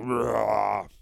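a beastly roar; monster roar. Acted. Rancor roaring at the bear, beastly. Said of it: "the Rancor is like".

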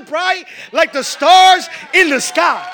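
slow, loud, high proclamation; preaching shout. Acted. Preacher urging the church to shine, slow full shout. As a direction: shout it out, stretching each word. Preacher reaches climax of the verse.

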